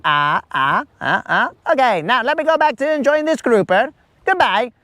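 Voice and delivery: a flustered guy bailing out; mock awkward dismissal. Colin fleeing the woman, hammed-up awkward voice. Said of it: "you're like".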